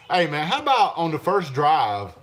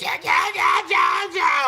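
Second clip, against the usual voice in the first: high, loud mocking yeah yeah; mocking commenter; 5 dB louder, slower than usual. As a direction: taunt like a know-it-all.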